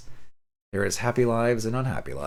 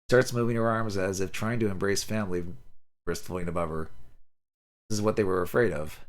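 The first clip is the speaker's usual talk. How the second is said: plain reading voice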